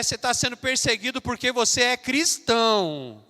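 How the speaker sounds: fiery Sunday sermon shouting to the congregation; full-force preaching. Acted. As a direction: preach it hard to the whole church.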